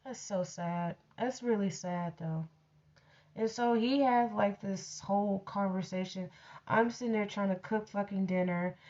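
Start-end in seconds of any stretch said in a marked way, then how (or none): none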